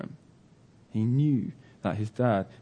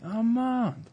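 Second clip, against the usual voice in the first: high, falling call without real volume; stretched-out searching call, held back; mock shouting; 13 semitones higher than usual. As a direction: imitate shouting from afar for a son. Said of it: "he's shouting".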